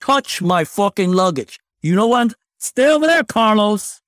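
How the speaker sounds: put-on New York accent. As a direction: bark it like a pushy New Yorker. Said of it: "he talks like a New York accent".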